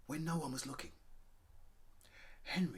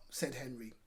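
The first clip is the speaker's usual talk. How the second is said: usual speaking voice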